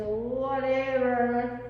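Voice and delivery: slow, drawn-out whatever; bored whatever. Retold or imitated. Roommate shrugging off a dinner question, slow and bored. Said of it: "And they say".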